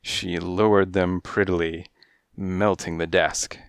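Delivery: ordinary delivery, nothing marked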